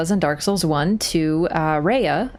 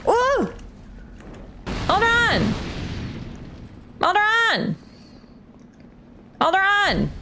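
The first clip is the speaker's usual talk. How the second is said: calling out; 11 semitones higher, slower than usual. Real, not acted. Shouting after a fleeing enemy.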